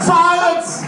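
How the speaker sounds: sudden high, loud command; barked order to be silent. Acted. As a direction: cut the room off dead.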